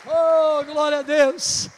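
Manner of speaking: loud, slow preacher's hallelujah; preaching praise. Acted. Preacher shouting praise to the church, loud and drawn out. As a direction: proclaim it to the congregation.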